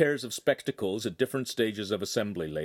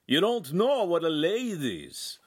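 put-on higher, slow, accented disbelief; surprised master; 7 semitones higher, slower than usual. A narrator's reading. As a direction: gasp at such ignorance. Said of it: "looked surprised".

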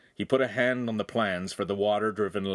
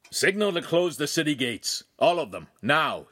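a raised command to the rooftop; firm raised call; 6 semitones higher, 3 dB louder than usual. Acted. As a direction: call the order up to the roof. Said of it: "he called".

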